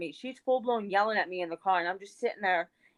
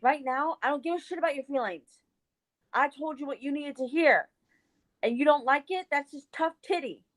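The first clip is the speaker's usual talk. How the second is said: higher-pitched, no-nonsense scolding; retold angry telling-off; 6 semitones higher than usual. Retelling telling off her friend in the car, fed up. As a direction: lay down the law without shouting. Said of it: "that was a little bit of your mom coming out".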